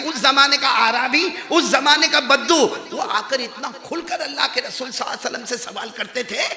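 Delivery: forceful, high-pitched preaching; preaching at full force. Real, not acted. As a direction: project it to the whole congregation.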